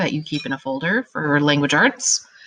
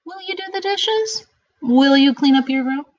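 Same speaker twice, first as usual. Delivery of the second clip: high, rising questions; asking a question; 9 semitones higher, 5 dB louder than usual. Shown as a demonstration. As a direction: ask it, don't order it.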